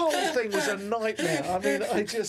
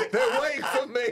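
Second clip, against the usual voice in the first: louder, called-out mock reminder; mock shout; 3 dB louder than usual. Comic repeating the host's hint as a mock shout. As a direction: shout it as a joke.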